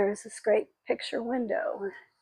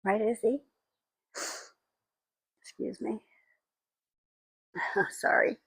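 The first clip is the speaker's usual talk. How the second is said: choked up; 3 semitones higher than usual. Real, not acted. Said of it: "I've been crying".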